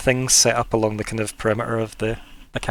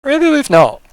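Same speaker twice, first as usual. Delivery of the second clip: high, loud put-on voice; sloth trying to remember; 9 dB louder than usual. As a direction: doubt yourself loudly in a high sloth voice. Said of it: "as if he's trying to remember your names and goes".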